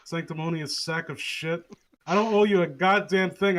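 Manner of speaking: normal talking voice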